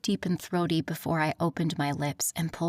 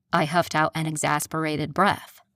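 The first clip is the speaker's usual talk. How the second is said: plain reading voice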